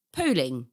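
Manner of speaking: "polling" with a long O; own pronunciation. Shown as a demonstration. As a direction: say "polling" as POLE-ing. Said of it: "I would say".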